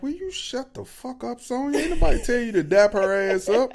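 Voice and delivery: silent scolding. Retold or imitated. Thinking at Sonya to stop, high hushed exasperation. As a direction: fume at Sonya under your breath.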